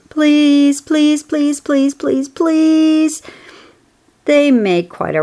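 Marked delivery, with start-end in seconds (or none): 0.1-3.2 high, whining please after please; teasing goslings. A narrator's reading. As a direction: nag like a pack of goose chicks. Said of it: "all seven began teasing to go".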